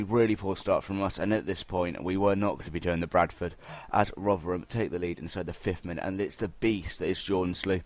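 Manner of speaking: ordinary talk, nothing marked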